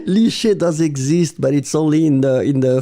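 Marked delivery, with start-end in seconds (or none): 0.0-1.4 reading the word out through a laugh; amused laugh. Real, not acted. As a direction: laugh at the silly answer. Student's odd word amuses teacher.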